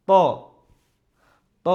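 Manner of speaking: "ত" as one short rounded "to"; full-mouthed Arabic Ta. Shown as a demonstration. Teacher modelling the letter, short single "ত". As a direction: say "ত" as a single syllable, no "-ya" glide. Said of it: "এই ত এর উচ্চারণের ক্ষেত্রে".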